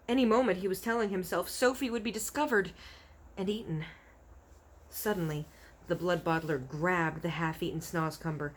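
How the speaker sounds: ordinary delivery, nothing marked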